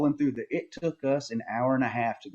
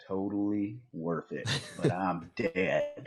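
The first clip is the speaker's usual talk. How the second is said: soft, slow and spent; mock exhausted mumble; imitating exhausted friend; 6 dB quieter, slower than usual. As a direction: drag it out quietly, like a worn-out hunter. Imitating exhausted friend after pack-out.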